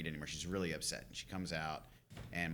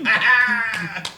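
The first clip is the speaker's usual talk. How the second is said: gleeful yelp; 13 semitones higher, 9 dB louder than usual. Pretend. Imagined glee at inheriting army.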